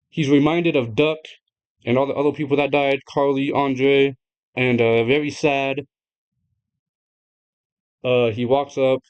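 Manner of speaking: usual speaking voice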